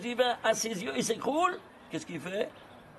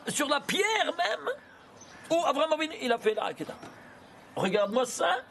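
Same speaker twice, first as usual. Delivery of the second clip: excited; 7 semitones higher than usual. Real, not acted. Marvelling at the numerical result.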